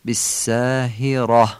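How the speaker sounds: بالساهرة slowly, with a thick ر and short "a"; heavy ra. Shown as a demonstration. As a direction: end بالساهرة on a full, heavy "ra".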